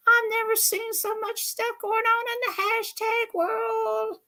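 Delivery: high, excited Southern-lady puppet voice; squeaky puppet gossiping about Miss Southern Belle; Minnie the puppet. Acted. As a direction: pitch way up and gush like a chatty puppet. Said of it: "she's my puppet".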